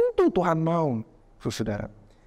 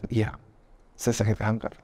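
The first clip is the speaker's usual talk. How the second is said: a touch of tears, dropped low and soft; mild tearful admission; 5 semitones lower, 4 dB quieter, slower than usual. Retold or imitated. Sick man finally admits it to the prophet, softly tearful. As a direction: give in quietly, a hint of crying. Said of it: "orangnya nangis".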